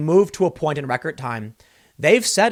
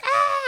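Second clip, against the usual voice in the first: hammed-up high scream; mock scream. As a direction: imitate a woman screaming in her car. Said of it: "she's just screaming at the top of her lungs".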